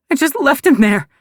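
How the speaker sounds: high, loud tearful cry. Acted. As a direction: break into tears on it. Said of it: "I start to cry again".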